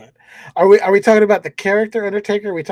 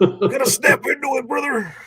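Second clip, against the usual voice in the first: Macho Man voice; 9 semitones higher than usual. Retold or imitated. In an Uber, doing Macho Man for the driver. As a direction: snap it out like a Slim Jim ad. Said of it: "doing Macho Man voice".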